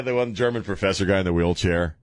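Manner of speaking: normal talking voice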